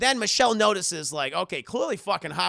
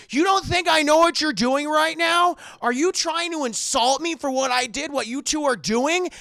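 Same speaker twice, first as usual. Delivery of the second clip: raised, loud accusatory rant; acted paranoid outrage; furious Howard; 9 semitones higher, 7 dB louder than usual. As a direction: confront them at high pitch and volume.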